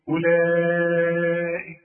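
أولئك held very slow and loud on the stretch. Shown as a demonstration. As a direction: hold the madd out fully. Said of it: "علشان تمدها الإيه". (مد طويل بصوت عالٍ في أولئك)

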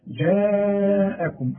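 جاءكم with the alif stretched very long; long madd. Shown as a demonstration. Teacher demonstrating the obligatory madd. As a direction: hold the long alif of جاءكم for four beats. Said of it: "المد اللي تمده قد إيه".

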